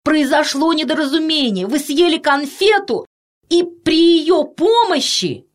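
high, insistent calling. A narrator's reading. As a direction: shout an explanation up to her. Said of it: "закричал детский доктор". (кричит снизу высоким голосом)

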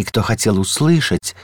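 ordinary delivery

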